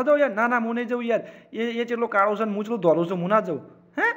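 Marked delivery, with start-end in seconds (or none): none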